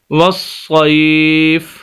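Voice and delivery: वसईफ stopped on a silent फ, slowly; वसईफ read slowly as a stop at the end of the verse; pause form. Shown as a demonstration. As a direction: end वसईफ on a bare फ with no vowel. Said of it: "अगर हम यहाँ पर वक्फ़ करें".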